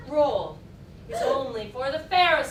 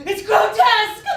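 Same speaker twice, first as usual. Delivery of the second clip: loud, much higher indignant cry; indignant outburst; 8 semitones higher, 13 dB louder than usual. Acted. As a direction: burst out in disgust.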